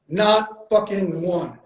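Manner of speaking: ordinary talk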